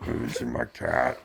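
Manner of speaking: put-on whispered, slow old lady murmur; breathy confused old woman asking; dotty absent-minded old lady. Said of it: "Your turn".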